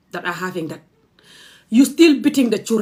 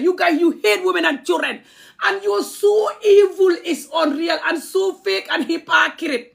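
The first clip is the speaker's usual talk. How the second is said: raised, slowed-down furious yelling, higher; angry shout; 6 semitones higher, slower than usual. Real, not acted. Ranting at commenters who threaten her, angry shout.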